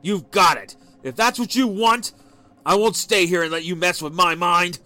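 loud, high furious tirade; husband raging at wife; furious yell. A narrator's reading. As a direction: spit it out, storming off. Husband yelling before leaving.

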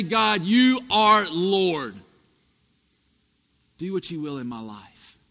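slow, earnest surrender to God; sincere prayer. Shown as a demonstration. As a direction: pray it slowly and mean it. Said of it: "to truly say".

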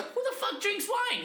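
very high-pitched disbelief; incredulous. Retold or imitated. Ribbing his friend for buying wine, incredulous. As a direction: squeal it in mock disbelief. Mock outrage at wine choice.

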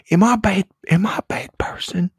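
high then whispered mock drunk question; drunk self-imitation. Retelling how she asks friends when drunk, high then whispered. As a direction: ask it like a needy drunk. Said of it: "I'll question my friends".

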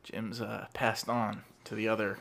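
low, hesitant creepy-neighbour voice; awkward neighbour. Acted. Plays awkward neighbour Daniel.

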